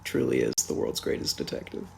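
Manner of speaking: whispered dry sarcasm; sarcastic whisper. Real, not acted. Reviewer whispers sarcasm about Batman, unvoiced. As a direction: breathe it out with mock awe.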